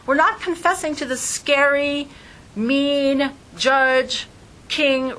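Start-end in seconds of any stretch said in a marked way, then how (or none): none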